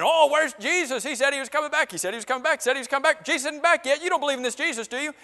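high, taunting singsong of doubters; mocking scoffers. Scoffers taunt believers about Jesus not returning, mocking. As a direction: jeer in a high voice, repeating the taunt. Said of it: "here are these mockers mocking, saying".

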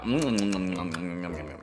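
mock eating noises. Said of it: "be like".

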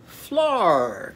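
"flar" with a long loud falling "ar"; vowel swapped to "ar". Shown as a demonstration. Teacher puts Arnie's sound into fly, stretched "flar". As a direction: draw out the end of "flar". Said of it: "Now it's going to be a".

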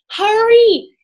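high loud call. A narrator's reading. Annie calling to the serpent monster. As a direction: shout it across the water. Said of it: "Annie called to the serpent monster".

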